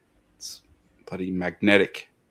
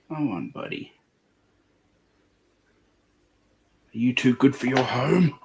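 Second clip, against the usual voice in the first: pet talk; 6 semitones higher than usual. Real, not acted. Coaxing a pet, higher voice.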